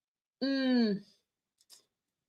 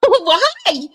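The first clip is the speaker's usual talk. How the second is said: ordinary talk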